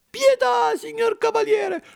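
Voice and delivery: acutissima e disperata; supplica piangente. A narrator's reading. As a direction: implora piangendo a voce alta. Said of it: "chiangendo a cieli pierti". (Pinucciu implora il burattinaio piangendo, acutissimo)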